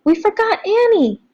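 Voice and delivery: put-on urgent girl's shout; yelling. A narrator's reading. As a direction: stop everyone like a policeman. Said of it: "puts her hand up like a policeman and yells".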